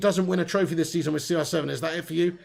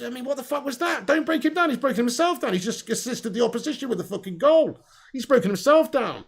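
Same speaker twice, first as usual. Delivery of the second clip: pitched up and fed up, cursing at the chat; ranting that the player wrecked himself; exasperated outburst; 6 semitones higher than usual. Real, not acted. Streamer answering a viewer who defends Lingard, exasperated. Angry reply to a chat comment.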